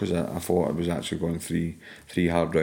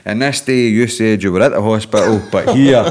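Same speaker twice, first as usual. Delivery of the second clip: mock boss accusation; 3 semitones higher, 11 dB louder than usual. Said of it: "boss brings out papers".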